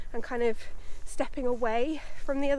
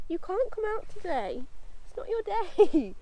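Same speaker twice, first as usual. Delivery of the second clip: higher, playful scolding of the horse; teasing pet voice; 6 semitones higher than usual. Real, not acted. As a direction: tease the horse that it stays home. Teasing horse left behind.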